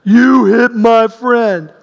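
slow, loud giant's voice; big man threatening, slow and loud; huge man's threat. Retold or imitated. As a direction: loom over him and growl it slowly. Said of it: "he said something like this".

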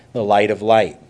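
misreads "the light of light" with light said twice; a quick verbal slip, "the light of light"; accidental misquote "the light of light"; slip of the tongue. Real, not acted. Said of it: "I'm sorry, the light of life".

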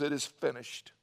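ordinary delivery

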